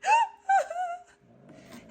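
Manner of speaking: very high excited squeal; squealing with delight. Real, not acted. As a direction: shriek with joy then laugh. Kitten revealed again.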